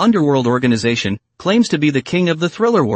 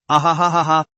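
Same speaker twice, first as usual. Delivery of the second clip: crazed cackling laugh; manic laugh. A narrator's reading. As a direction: laugh like she has lost her mind. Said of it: "in a fit of epileptic laughter".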